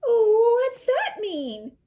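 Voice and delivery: put-on high, slow, puzzled child; little girl voice. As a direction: ask it like a bewildered small girl. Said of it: "talk and sound like her".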